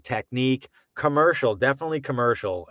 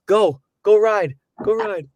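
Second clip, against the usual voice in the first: excited send-off; 9 semitones higher, 6 dB louder, slower than usual. Retold or imitated. Teacher sending students off.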